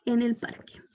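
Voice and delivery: whisper. Real, not acted. Whispered translation.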